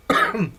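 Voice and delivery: loud, quick throat clear. Real, not acted. Clears throat before continuing.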